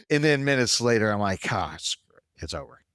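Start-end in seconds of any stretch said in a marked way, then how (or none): 1.5-2.8 giving up. Retold or imitated. Fan giving up on his losing team, dejected mutter. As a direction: lower and softer, dejected.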